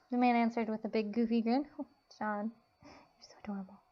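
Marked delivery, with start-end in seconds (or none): 1.8-3.8 soft mocking whisper; whispered coo. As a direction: coo it under your breath. Teasing cooing at John.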